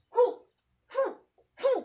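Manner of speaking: short exhaled hu on each strike; kiai grunts. Shown as a demonstration. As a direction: snap out a hu per punch. (matinding hu sa bawat suntok)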